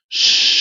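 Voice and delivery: long unvoiced shh; sustained rushing hiss that fades; imitated crowd hush. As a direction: mimic an audience falling silent. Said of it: "the whole audience is like".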